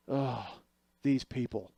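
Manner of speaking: subdued, sighing disdain; weary exasperation. Retold or imitated. Doctor reacting to how the nurses did it, weary exasperation. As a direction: imitate a doctor sighing in resignation.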